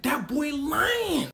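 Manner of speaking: Super Cop denying it, slow; slow denial. Retold or imitated. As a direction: drawl out the denial. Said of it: "He said".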